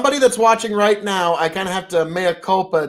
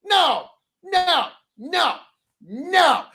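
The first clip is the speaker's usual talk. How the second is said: high, incredulous outburst; stunned disbelief; 11 semitones higher, slower than usual. Retold or imitated. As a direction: react like you can't believe it. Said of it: "And I'm like".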